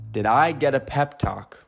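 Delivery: usual speaking voice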